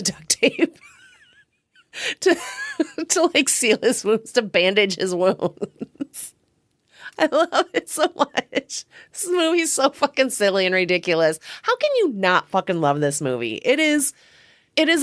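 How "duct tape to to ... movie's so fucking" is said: real giggles breaking up the sentence; laughing. Real, not acted. Fan recounting the duct tape wound scene, helplessly laughing. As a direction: struggle to get the words out through laughter.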